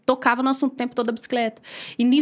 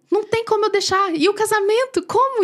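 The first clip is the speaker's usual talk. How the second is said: arguing protest; 8 semitones higher than usual. Retold or imitated. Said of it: "E eu debatendo".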